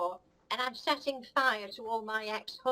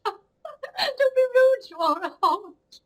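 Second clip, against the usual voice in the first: high, loud, laughing protest; laughing outburst; 8 semitones higher, 10 dB louder than usual. Real, not acted. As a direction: squeal it out through laughter.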